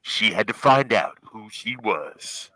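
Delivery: slow, dramatic trailer announcer; slow epic voiceover for a movie trailer. Retold or imitated. Continues the trailer voice bit.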